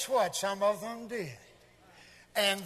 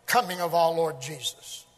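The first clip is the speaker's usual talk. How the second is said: usual speaking voice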